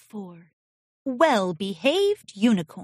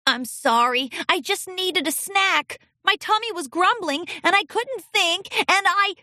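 put-on higher child voice, apologetic; young colt voice; 8 semitones higher than usual. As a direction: plead like a nervous little colt.

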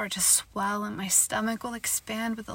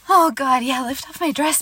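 ordinary delivery

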